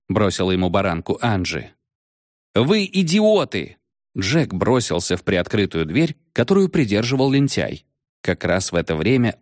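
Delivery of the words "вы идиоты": high slow alarmed cry; panicked yell. Acted. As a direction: shriek it at your friends.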